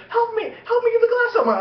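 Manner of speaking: very high falsetto mock plea; pleading girlfriend. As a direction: beg for help in an exaggerated girlfriend voice. Imitates girlfriend begging for help.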